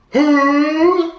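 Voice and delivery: high, loud, drawn-out hoot; mock yelled horse call. Rider yelling across the beach at horse, mock panicked call. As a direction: belt out a hooting call to halt a horse. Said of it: "now I'm yelling".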